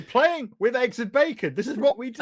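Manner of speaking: high-pitched, animated protest; exasperated protest. Real, not acted. Player protesting to the group about dicking around, exasperated.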